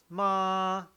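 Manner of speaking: "ma" high, loud and level; steady, sustained high "ma"; first tone. Shown as a demonstration. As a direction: hold "ma" at the top of the range. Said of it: "it's the same".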